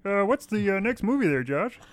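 high puppet-frog impression; Kermit the Frog. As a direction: put on a high, hesitant frog voice. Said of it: "My Kermit the Frog will have to suffice".

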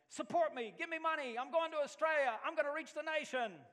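high, loud fundraising pitch; rally the home church with gusto; young man begging for support, all fired up; excited pitch. Retold or imitated.

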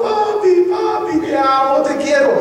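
son's tearful cry for his father, pitched high. A narrator's reading. Yosef weeping as he embraces his father, crying out high. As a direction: call out papi high, like a son weeping. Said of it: "Su hijo Yosef llorando".

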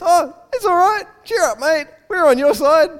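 very high, chummy reassurance; hammed-up cheery turnabout; mock matey encouragement; suddenly friendly crowd. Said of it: "And then suddenly it's".

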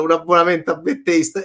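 ordinary talk, nothing marked

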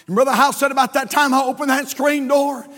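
ordinary talk

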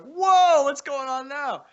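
high, loud startled whoa. Retold or imitated. A giant rooster wanders onto the terrace, startled whoa. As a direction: yelp it as a huge rooster walks in.

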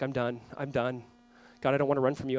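hushed prayer of surrender; weary surrender. Acted. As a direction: give up quietly, as a confession. Voices a runner surrendering to God.

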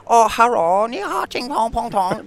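high, exaggerated fake Chinese accent; mock Chinese accent. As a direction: caricature a Chinese accent, loud start, high pitch.

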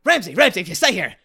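loud, high, frantic mad scientist; Doc Brown impression. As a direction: warn him like a panicked Doc Brown. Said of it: "another Christopher Lloyd".

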